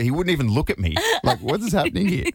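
amused baffled retelling; laughing. Real, not acted. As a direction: giggle while retelling. Quoting wife, laughing.

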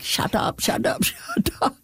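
high-pitched, hushed mock yell; half-laughing, squeaky imitation of a brother's yell; imitated screaming back. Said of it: "would start screaming back".

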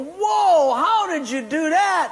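high, loud astonished whoa; amazed. Retold or imitated. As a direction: gasp it out in disbelief. Friends amazed at the trick.

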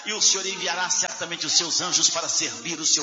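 fervent, half-sung prayer swelling to cries; closing prayer. Acted. Pastor praying aloud over the standing congregation, fervent sung prayer. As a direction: pray over the church, rising in fervour.

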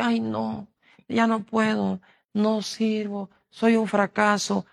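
mock the defeated complainer, slow on the last words; whiny self-pity.

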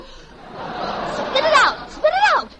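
mother shrieking at her son to spit out the rubber heel; alarmed shriek. Acted. Mother sees kid eating heel.

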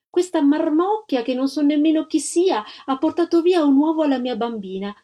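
put-on mildly outraged mother accusing a child; indignant mother. A narrator's reading. Offended mother complains about the other child.

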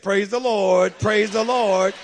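loud praise. Retold or imitated. Brother Cleve answering the rude golfer, loud praise. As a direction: shout it out to cool the moment. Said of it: "and Brother Cleve said".

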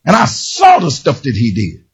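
ordinary talk

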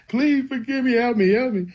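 high-pitched, imitated child's begging; retold crying plea. Crying boy begging God for forgiveness, imitated high pleading. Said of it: "I'm crying and I'm telling him everything".

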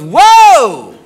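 loud, drawn-out whoa; acted shocked girlfriend's exclamation; startled whoa. Girlfriend goes whoa at the new underwear, loud and startled.

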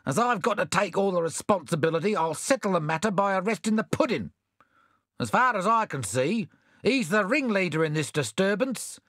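a hint of a grumbling constable, a little quick; put-upon constable. Constable to the mayor, mildly put-upon. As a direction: constable shifting the blame, lightly grumbling.

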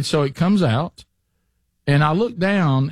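usual speaking voice

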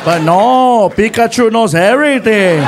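loud mock telling-off; friend's scolding voice. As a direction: scold the driver loudly, imitating a friend. Friend's acted scolding of driver.

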